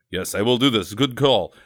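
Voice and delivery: put-on dutiful reply in a hazy German-Russian accent. Mletch agreeing to Jeff's order, in a comic German accent. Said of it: "I don't know why he's German now".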